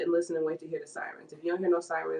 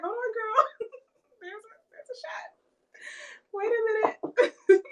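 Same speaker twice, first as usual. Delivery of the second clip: high, giggly mock alarm; squeaky reenactment breaking into giggles; laughing; 14 semitones higher, 4 dB louder, slower than usual. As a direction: barely get it out through laughter. Reenacts laughing through gunshot scare.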